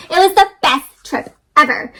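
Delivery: usual speaking voice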